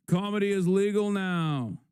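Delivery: high put-on voice for "Comedy is legal now"; mock Elon delivery; Elon Musk impression. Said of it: "Like Elon Musk".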